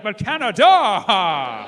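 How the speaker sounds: loud and very slow; hammed-up final call; mock slow shout. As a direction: drag the word out for the crowd.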